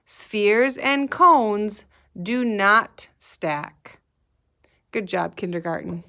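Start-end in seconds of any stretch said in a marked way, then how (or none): 0.0-4.0 slow "Spheres and cones", pause, then spaced-out "do not stack"; drawn out so young children can chant along; say-along pace. Shown as a demonstration. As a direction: lead the class in unison, unhurried. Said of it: "Say that with me".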